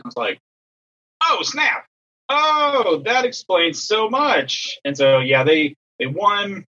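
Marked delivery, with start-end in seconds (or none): 1.2-4.8 high-pitched, drawn-out dawning realisation; acted-out "oh, now I get it"; sudden realisation. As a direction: let it dawn on you, high and stretched. Reacting to sudden realisation in story.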